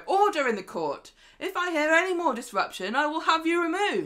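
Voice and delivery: high, loud courtroom command; mock-stern judge, projecting hard; judge calling order. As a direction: play a judge threatening to clear the court. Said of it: "They pick up their gavel".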